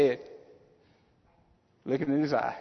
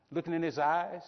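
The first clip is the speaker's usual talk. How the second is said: ordinary delivery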